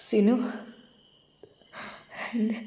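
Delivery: whispered through tears; broken, tearful whisper; choked whisper. A narrator's reading. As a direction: barely get the name out while weeping. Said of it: "പൊട്ടിക്കരയുകയാണുണ്ടായത്".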